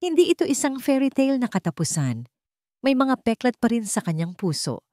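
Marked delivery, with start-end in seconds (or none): none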